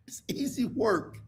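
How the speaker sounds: voiceless whisper aside. Pretend. Comic leaning in to the audience, whisper. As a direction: breathe it as a secret.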